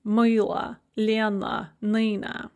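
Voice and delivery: "Moila, Lena, Nina" each ending in a weak uh; Russian list "Moila, Lena, Nina", stress first, uh endings; final vowel as "uh". Shown as a demonstration. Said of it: "pronounced kind of an uh sound".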